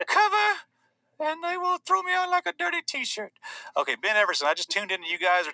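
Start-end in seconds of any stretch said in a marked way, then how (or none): 0.1-0.5 squeaky mock-girl voice on the word; Greta impression. Activist correcting her slip, high-pitched parody. 1.2-3.3 very high mock-aggrieved girl; Greta impression. Activist complaining about being discarded, high-pitched parody.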